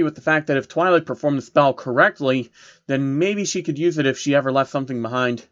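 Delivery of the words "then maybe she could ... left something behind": ordinary talk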